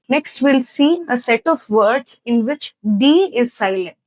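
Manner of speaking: ordinary talk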